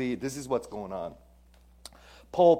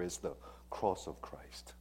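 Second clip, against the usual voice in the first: whispered, much softer and lower; reverent whisper; 5 semitones lower, 11 dB quieter than usual. Real, not acted. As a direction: breathe the words in awe. Reverent climax of contrast.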